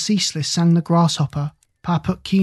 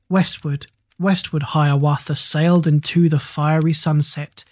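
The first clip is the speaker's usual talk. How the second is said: plain reading voice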